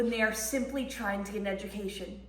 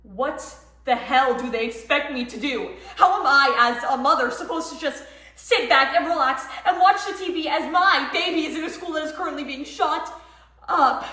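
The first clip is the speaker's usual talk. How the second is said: loud, raised, furious protest; outraged outburst; 4 semitones higher, 7 dB louder than usual. Acted. Mother told to stay away from her endangered son, outraged. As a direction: burst out in helpless anger.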